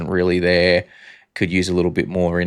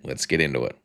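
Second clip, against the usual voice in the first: much lower and much softer; low quiet echo; 9 semitones lower, 8 dB quieter than usual. Acted. Host echoing his own line to the co-host, low and quiet.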